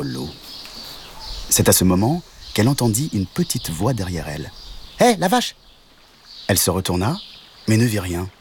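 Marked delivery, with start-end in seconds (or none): 5.0-5.6 high, bright call from the ditch; little frog calling. Acted. Little frog calls out to the cow, high and lively. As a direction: hail the cow in a tiny high voice.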